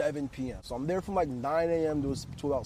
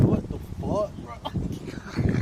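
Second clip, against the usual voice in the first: laughing outburst; 7 semitones higher, 5 dB louder, much slower than usual. Retold or imitated. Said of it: "I said".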